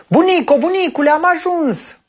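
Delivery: put-on high excited child calling out. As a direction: call to the grandparents from the gate, thrilled. Copiii strigă la sosire. (copil strigând entuziasmat, cu voce subțire)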